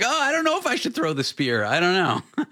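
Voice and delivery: high, dithering mock caveman hunter; high, hesitant voice of a timid hunter; awkward hunter. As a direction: play the nervous hunter who can't commit to the throw. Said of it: "the awkward hunter is like".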